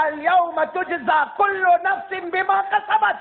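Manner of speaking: high, slow proclaimed verse; preacher declaiming the verse of judgment; solemn verse call. Acted. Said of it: "پھر اللہ کہے گا".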